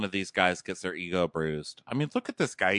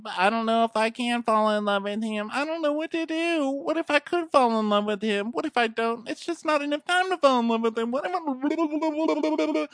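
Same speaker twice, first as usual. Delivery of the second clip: very high, whiny dithering; hammed-up high-pitched romantic meltdown; mock panic. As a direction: wail hysterically and spiral into babble. Voicing a panicked woman's love dilemma.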